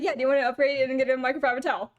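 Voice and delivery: hurried, run-together upsell; rapid-fire patter, amused; fast talker. Retold or imitated. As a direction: imitate the attendant speeding through it. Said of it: "talking so fast".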